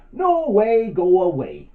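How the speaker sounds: high, loud, drawn-out cry; defiant refusal. Acted. Little butterfly to the hungry spider, defiant high shout. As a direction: shoo it off in a big high voice. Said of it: "put out his wings ... And he said".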